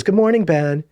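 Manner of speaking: high, lilting imitation of a sweet teacher; sweet teacher greeting. Teacher greeting a shy pupil at school, sweet high imitation. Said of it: "this very sweet young woman".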